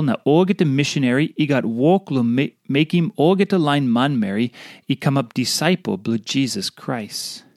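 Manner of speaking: ordinary delivery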